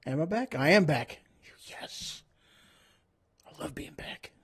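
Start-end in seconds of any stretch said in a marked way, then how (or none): 1.7-4.3 soft breathy whisper. Real, not acted. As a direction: whisper it like a secret. Playful whispered aside.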